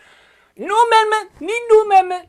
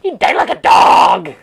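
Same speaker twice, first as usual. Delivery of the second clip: much lower, loud declaration; a loud, low-voiced proclamation; low shout; 11 semitones lower, 8 dB louder than usual. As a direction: boom it out from low in the chest. Exclaimed twice, first take low.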